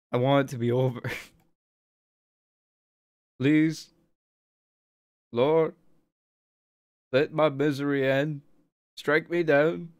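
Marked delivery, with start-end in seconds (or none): 3.4-9.9 mock prayer. Mock prayer for misery's end.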